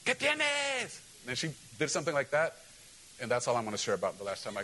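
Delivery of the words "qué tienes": south side Spanish challenge. Retold or imitated. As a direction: bark it like a south side local. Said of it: "that's how they talk".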